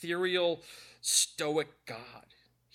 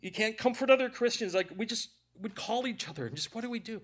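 real grief, voice pushed higher; crying; 6 semitones higher, 4 dB louder than usual. Real, not acted. Preacher recalling a loved one lost this year, tearful. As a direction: talk through tears. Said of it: "all that crying out of there".

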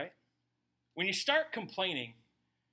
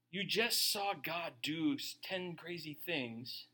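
ordinary delivery

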